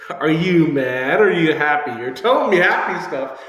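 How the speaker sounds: loud, high, stretched-out disbelief; confused questioning. Acted. Player to coach about mixed messages, confused.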